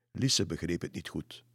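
plain reading voice

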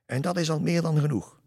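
high, loud mocking jester; a taunting fool calling from the trees; jester's voice. Jester mocks the hunchback from the woods, high and loud. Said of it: "een andere stem".

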